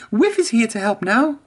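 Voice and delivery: high, confident engine voice; Emily's voice. A narrator's reading. Emily tells Spencer about Whiff, high. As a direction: announce help has come, high.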